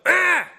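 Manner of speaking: put-on high, loud frustrated kid's yell; child's tantrum cry. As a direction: throw a little tantrum cry. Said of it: "throws a fit".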